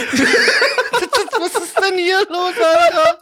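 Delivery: high, helpless laughter; hysterical laugh. Real, not acted. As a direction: laugh till you almost cry. Laughing fit, near tears.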